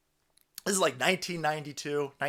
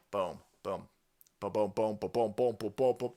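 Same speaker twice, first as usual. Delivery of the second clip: low booming beat; 8 semitones lower, 5 dB quieter than usual. Acted. Imitating a beat or explosions.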